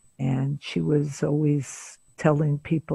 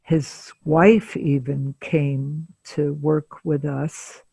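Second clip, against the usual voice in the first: ordinary talk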